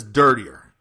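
put-on girl asking for dirtier talk; insistent demand. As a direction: demand it firmly. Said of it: "she says".

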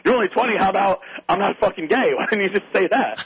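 high-pitched, mock-exasperated challenge; incredulous retort. Teller retells challenging the kid's excuse.

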